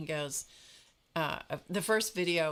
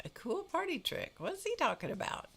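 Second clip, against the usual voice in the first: high-pitched, puzzled echo of his phrase; baffled reaction; 12 semitones higher than usual. Retold or imitated. Telling viewers her reaction to the video, high baffled echo. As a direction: squeak it back in mock bewilderment. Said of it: "And I'm like".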